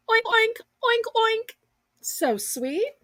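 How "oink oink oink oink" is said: high playful oinks; snorting oinking pig. Pretend. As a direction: oink like a little pig. Pig noises after the sheep.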